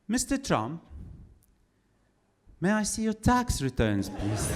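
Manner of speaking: mock British officialdom, stifling a laugh; polite British official, the punchline question; British accent. British accent, official demanding tax returns from Trump. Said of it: "I'm doing a British accent".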